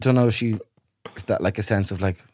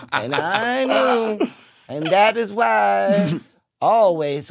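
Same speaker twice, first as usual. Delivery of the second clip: Southern storyteller. Pretend. Cohost improvising a Southern saga about the maid. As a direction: spin a melodramatic Southern family tale.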